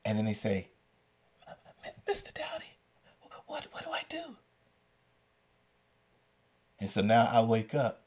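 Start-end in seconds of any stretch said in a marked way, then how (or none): 2.1-4.4 imitating a frightened student, tiny high stammered whisper; scared, trembling whisper. Student calling teacher for help, scared trembling whisper. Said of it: "the voice is scared and trembling".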